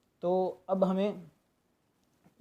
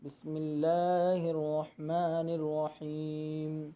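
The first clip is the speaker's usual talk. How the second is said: drawn-out recitation; 2 semitones lower, 3 dB quieter than usual. Shown as a demonstration. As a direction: recite slowly, stretching the end of रजीम. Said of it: "थोड़ा खींचना है". (आख़िर में खींचकर तिलावत)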